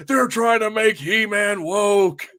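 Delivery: slow, high mocking whine of an angry fan; mocking outraged fan. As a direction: parody the outraged fanboys. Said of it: "people lost their mind".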